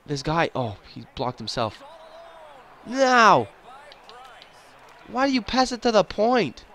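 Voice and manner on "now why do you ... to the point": high-pitched frustrated wail; real whining exasperation, pitched up high; exasperated protest. Real, not acted. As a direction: cry out at a teammate's bad pass. Scolding own player after bad pass.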